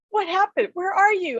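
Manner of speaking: high, distressed calling out; upset pleading. Retold or imitated. Widow calling for her late husband, high and upset. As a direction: plead into the empty room, hurt. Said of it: "And I was so upset".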